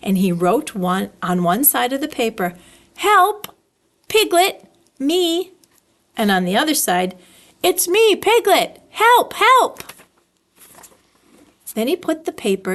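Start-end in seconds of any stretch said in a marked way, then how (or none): 3.0-5.5 little cry for help. Acted. Piglet's written cry for help. 7.6-9.8 higher and louder help, help; rising panic of a tiny piglet; building cry for help. Acted. Piglet's note, building plea.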